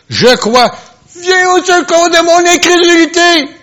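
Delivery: high, loud desperate cry. Acted. Father crying out to Jesus for his son, loud and high. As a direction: cry out for help at the top of the voice. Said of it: "le père de l'enfant s'écria".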